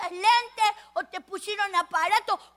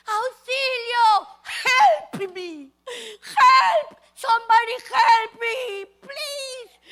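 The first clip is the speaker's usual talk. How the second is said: high, slow, desperate calls; crying for help; 5 semitones higher, 7 dB louder, much slower than usual. Acted. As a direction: beg for rescue, panicked. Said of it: "Lucas pedía auxilio".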